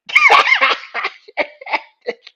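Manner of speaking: very high, loud burst of laughter; real uncontrolled cackle; big laugh. Real, not acted. Cracks up at the retold story.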